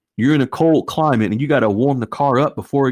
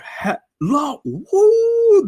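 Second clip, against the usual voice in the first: high joyful woo; excited whoop; much slower than usual. Real, not acted. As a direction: cry out with delight. Overjoyed at flood of hands.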